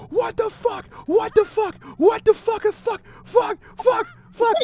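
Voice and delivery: shrieking way up high, loud and frantic; panicked screaming. Retold or imitated. Andrew, leaping out of bed after the ghost lunges, panicked high scream. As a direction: scream it like something just jumped you in the dark. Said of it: "And Andrew fucking screams".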